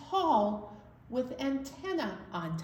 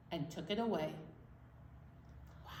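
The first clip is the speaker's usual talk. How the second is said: ordinary delivery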